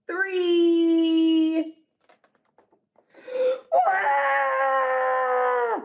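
loud hammed-up shriek; monster scream. Monsters screaming at their reflection. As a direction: shriek in mock fright.

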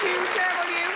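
high sassy catchphrase. Acted. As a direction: toss the line out in her high voice. Comic repeats catchphrase.

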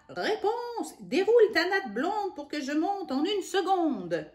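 high, loud call up the tower; witch calling up. Acted. Witch shouts up to Réponse from below, high loud call. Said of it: "elle criait d'en bas".

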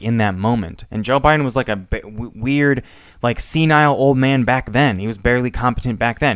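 usual speaking voice

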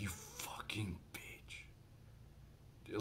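slow, seething whisper; very quiet, drawn-out angry whisper; flared-up anger. Retold or imitated. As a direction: whisper the curse as a private thought. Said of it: "my anger flared up".

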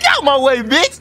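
loud shout at another player; brash shout. Real, not acted. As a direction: yell them out of the road. Yelling at player in game.

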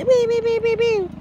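squeaky repeated squeals; silly high-pitched noises; high playful wee-wee. Acted. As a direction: squeal while crashing the shot. Playful intrusion into shot.

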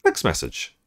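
usual speaking voice

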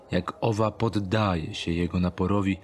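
plain reading voice

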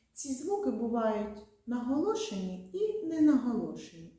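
ordinary talk, nothing marked

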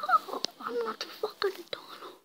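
high-pitched exasperated gamer; real frustrated whine; exasperated protest. Real, not acted. Frustrated outburst during the game.

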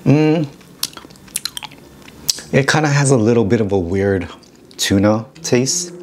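chewing while talking; speaking between bites; mouth full of food. Real, not acted. Seen 0: fork to mouth at -2.0, jaw working between spoken phrases.

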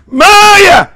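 high, sudden full-force cry; shouted tongues. Real, not acted. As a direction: explode into one shouted word. Outburst in tongues.

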